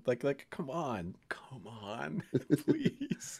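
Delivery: slow, hushed, half-laughing plea; pleading whisper. Real, not acted. Co-host begging the show for what he wants, hushed pleading. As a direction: beg softly, fading into a whisper.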